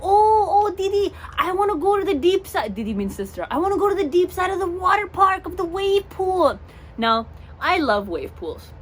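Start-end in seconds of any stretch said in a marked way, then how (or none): 0.0-2.7 put-on high, loud excited little boy; excited child voice, higher and louder; kid cousin. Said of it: "he's like". 3.5-6.6 put-on high, loud begging child; kid cousin shouting. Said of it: "he's like".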